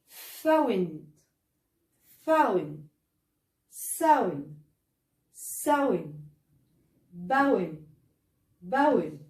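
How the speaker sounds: each word with an ow diphthong. Shown as a demonstration. Teacher reading ow words for the class. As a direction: model the ow glide in each word. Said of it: "تعالوا نشوف الكلمات دي".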